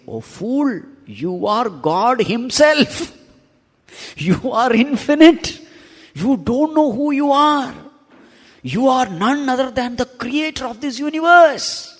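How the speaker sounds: high, impassioned proclamation; voice of scripture. Acted. Scripture rebuking one who thinks they are finite, impassioned proclamation. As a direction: declaim it like scripture rousing a fool. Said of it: "What does scripture say?".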